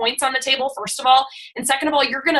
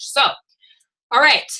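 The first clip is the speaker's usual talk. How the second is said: ordinary talk, nothing marked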